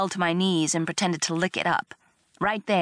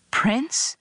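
higher, rising questioning call; tentative; 7 semitones higher than usual. A narrator's reading. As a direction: test the word, buying time. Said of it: "I said tentatively".

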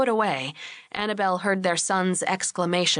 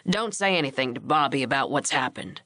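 put-on lower-pitched older rancher; instructing husband; 6 semitones lower than usual. A narrator's reading. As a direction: give a lightly voiced low instruction. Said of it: "he instructed Annabelle".

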